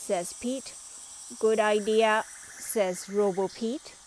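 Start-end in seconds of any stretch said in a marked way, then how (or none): 1.4-2.2 robot voice. A narrator's reading.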